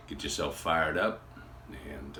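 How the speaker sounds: ordinary talk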